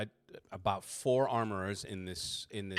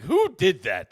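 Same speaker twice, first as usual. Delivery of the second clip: loud angry demand; mad yell; 9 semitones higher, 11 dB louder, slower than usual. Acted. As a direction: snap at him, outraged. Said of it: "He's getting mad".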